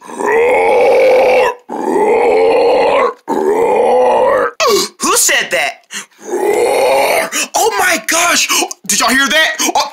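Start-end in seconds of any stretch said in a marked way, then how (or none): 0.1-4.5 deep, growling bear roar; a rumbling beast roar from the bushes; low roar. Acted. Hidden bear scares the turtles. 6.2-7.3 bear roar. Acted. Bear roars again.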